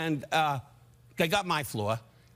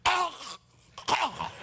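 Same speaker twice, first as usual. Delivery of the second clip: mock startled cry; 12 semitones higher than usual. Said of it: "One guy on the phone went".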